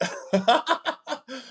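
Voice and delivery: bursts out in a high laugh. Real, not acted. Cohost laughing at her partner's joke, a high laugh.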